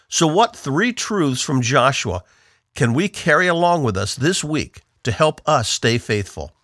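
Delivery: usual speaking voice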